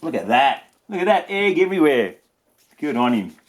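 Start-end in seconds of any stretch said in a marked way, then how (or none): none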